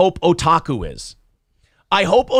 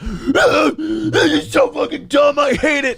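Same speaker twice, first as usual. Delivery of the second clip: mock hater rant; 8 semitones higher than usual. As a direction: ham up an outraged online critic. Mocking haters react like clockwork.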